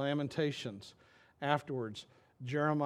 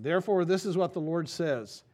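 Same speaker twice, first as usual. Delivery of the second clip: louder, emphatic reading; scripture reading; 3 semitones higher, 5 dB louder than usual. Acted. Pastor to congregation, announcing God's word firmly.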